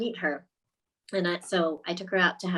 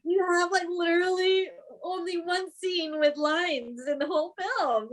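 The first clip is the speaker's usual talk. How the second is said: high-pitched retold persuasion; eager reassurance; 9 semitones higher than usual. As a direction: coax a reluctant actress, pitched up high. Retells reassuring the reluctant actress.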